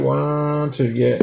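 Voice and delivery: usual speaking voice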